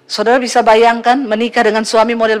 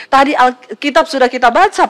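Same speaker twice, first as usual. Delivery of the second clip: ordinary delivery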